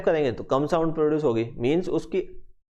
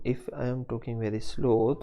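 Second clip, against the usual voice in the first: drawn out and softer; slow soft demo; 3 semitones lower, 5 dB quieter, much slower than usual. Shown as a demonstration. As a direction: stretch the words out slowly, quietly. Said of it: "अगर मैं slow बोल रहा हूँ". (धीमी, नरम आवाज़ में धीरे-धीरे)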